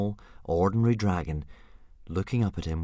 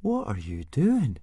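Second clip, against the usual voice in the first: slow, high-pitched puzzled question; curious; 6 semitones higher, slower than usual. A narrator's reading. Fishlegs catches Hiccup talking oddly, curious. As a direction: ask it with drawn-out curiosity. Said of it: "asked Fishlegs curiously".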